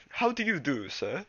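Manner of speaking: high hammed-up British accent; posh British accent. Shown as a demonstration. Reader showing Twolegs' British voice, high mock British. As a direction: play a prim Englishman. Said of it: "they're gonna be British".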